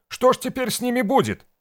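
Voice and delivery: very high, loud anxious wail; distressed hostess. A narrator's reading. Hostess fretting over what happens to them, wailing. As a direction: cry out in high-pitched alarm. Hostess laments the friends' fate.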